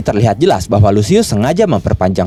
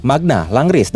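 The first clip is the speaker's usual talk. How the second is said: ordinary delivery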